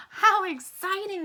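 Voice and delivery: high, loud and slow, full of glee; a polar bear giggling over a surprise parcel. A narrator's reading. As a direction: squeal it out with a delighted giggle. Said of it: "he giggled".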